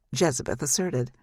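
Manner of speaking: ordinary delivery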